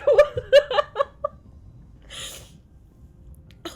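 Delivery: long loud laughing wail; real laugh-cry wail; laughing sob. Real, not acted. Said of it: "laughing and crying at the same time".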